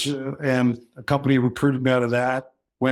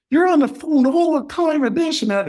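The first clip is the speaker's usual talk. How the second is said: much higher and louder, wife complaining; mock nagging wife; 13 semitones higher, 4 dB louder than usual. Husband retelling his wife complaining he's always on the phone, high mock nag. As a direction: play the fed-up wife, pitched way up. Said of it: "and she goes".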